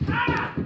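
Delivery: sudden sharp yelp of pain; pained cry from a fall. Real, not acted. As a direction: cry out as you slip and fall. Slips and falls while filming.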